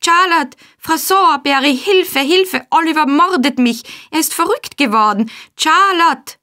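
high, loud panicked calls; screaming for help. A narrator's reading. Noah calling the women for help, high loud screams. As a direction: yell for rescue at the top of the voice. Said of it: "Noahs Hilfegeschrei".

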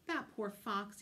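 ordinary delivery, nothing marked

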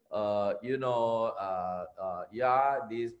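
low, slow, lifeless mumble with hesitant uhs; dull mumbling demo. Shown as a demonstration. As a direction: drag it out like a speaker with no energy. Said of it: "I, I cannot talk like this".

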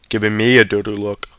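ordinary delivery

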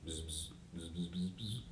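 very soft buzzing; bee buzzing. Shown as a demonstration. Teacher being bees for children, soft buzzing. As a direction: buzz quietly like bees. Bee noise within the song.

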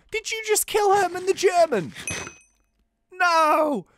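loud, high-pitched disbelieving yell; hammed-up vengeful shriek; mock outrage. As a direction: cry out at your friend's killer. Outrage at the friend's killing.

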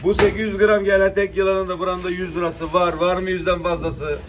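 auctioneer. Acted. Fish auction calling prices.

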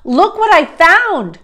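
high, loud, drawn-out call. A narrator's reading. Jack calling Annie about his find, loud slow call. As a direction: show off a find from afar. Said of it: "Jack called".

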